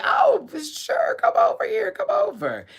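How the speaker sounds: high, slow gushing welcome; excited squeal. Retold or imitated. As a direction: squeal it out warmly. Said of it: "I was like".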